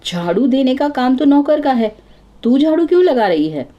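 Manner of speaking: a mother-in-law briskly scolding; mild scolding mother-in-law. Acted. Mother-in-law questions daughter-in-law.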